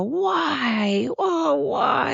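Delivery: pained victim. Pretend. Imagined victim whining after the punch. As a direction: squeak it out like a man just hit. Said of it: "they'll go".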